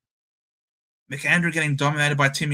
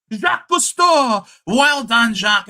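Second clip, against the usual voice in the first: higher, louder shout of joy, slowed on the name; excited shout; 4 semitones higher, 5 dB louder than usual. Real, not acted. Streamer cheering a rookie's goal: excited shout. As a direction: yell the name like he can't believe it. Player's surprise goal called out.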